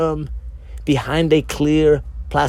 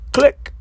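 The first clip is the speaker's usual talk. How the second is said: a sharp, high whispered click; 11 semitones higher than usual. A narrator's reading. Narrator voicing the light cord clicking on, high whispered click. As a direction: snap out a tiny click sound effect.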